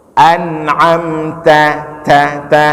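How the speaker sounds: "An'amta" drawn out, ending in a light open "ta"; thin ta. Shown as a demonstration. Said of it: "tipis saja".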